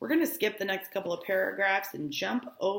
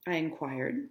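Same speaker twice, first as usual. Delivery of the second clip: normal talking voice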